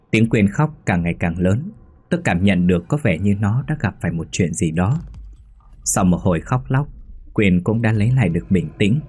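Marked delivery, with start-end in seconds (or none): none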